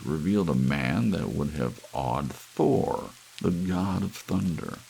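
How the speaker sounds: ordinary delivery, nothing marked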